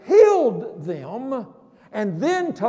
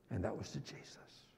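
whisper; 14 semitones lower, 21 dB quieter, faster than usual. Real, not acted. Hushed narration.

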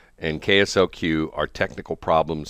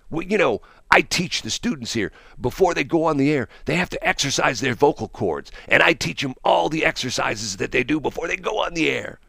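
higher, smarmy morning DJ schmoozing; puker DJ voice; 9 semitones higher than usual. Retold or imitated. Imitating DJ Charlie Brown greeting Shelly, smarmy high puker voice. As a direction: ham up a slick radio jock greeting Shelly. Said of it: "Charlie Brown was a puker".

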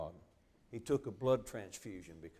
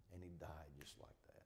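soft unvoiced hush, much softer; whispered; 5 semitones lower, 15 dB quieter than usual. Real, not acted. Preacher telling the congregation of his death, whispered. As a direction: let the words fall to a whisper.

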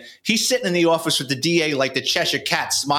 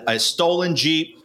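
usual speaking voice

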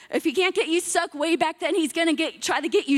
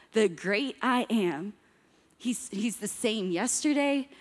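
ordinary talk, nothing marked

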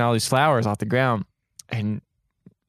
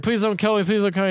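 begging for my life; 7 semitones higher than usual. Retold or imitated. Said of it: "begging for his life".

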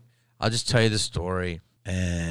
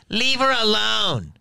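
high, slow, drawn-out shout; mock a lady calling out in protest; imitated heckler yell; 13 semitones higher, 5 dB louder, slower than usual.

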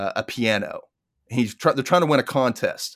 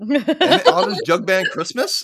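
excited; 8 semitones higher, 3 dB louder, slower than usual. Real, not acted. Excited recognition.

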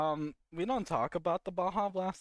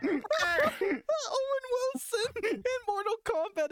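slow, high, laughing through the words; wheezing laugh through the line; laughing hard; 3 dB louder, much slower than usual. Real, not acted. Cracking up at own joke.

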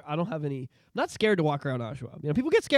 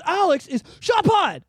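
excited recognition; 12 semitones higher, 6 dB louder, slower than usual. Retold or imitated. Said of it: "he goes".

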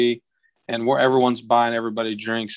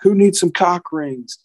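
slower, higher call-out to a crowd; imitated stage call; 6 semitones higher than usual. Bar owner holds up her hand to the crowd, imitated call-out. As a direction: lightly mimic a singer working the room.

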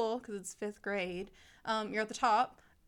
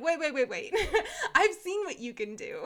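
higher, louder, breaking into laughter; laughing mock protest; 6 semitones higher, 5 dB louder than usual. Teacher to a slipping class, laughing mock protest. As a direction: halt them in mock disbelief, laughing.

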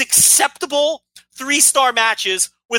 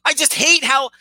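ordinary talk

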